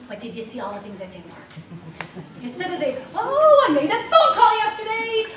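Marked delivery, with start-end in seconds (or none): 0.1-1.5 gloomy self-talk. Pretend. Mocking negative self-talk. 3.1-5.2 excited self-praise. Pretend. Mock proud self-cheer.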